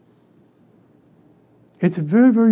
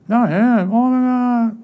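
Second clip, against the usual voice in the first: higher, drawn-out groan of a quote; oh-no reaction, hammed up; mock dismay; 5 semitones higher, slower than usual. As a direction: act out exasperated disbelief.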